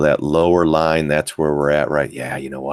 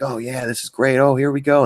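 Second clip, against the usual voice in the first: high, giddy fake cheer; mock excitement; 8 semitones higher than usual. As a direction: gush like an overeager trader. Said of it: "They're like".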